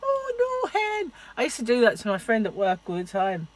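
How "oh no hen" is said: very high Scottish "oh no, hen"; high-pitched mock Scottish voice; high Scottish accent. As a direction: squeak it like a Scottish auntie. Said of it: "go high pitched when I'm doing".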